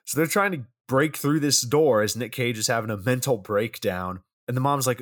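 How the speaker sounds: ordinary talk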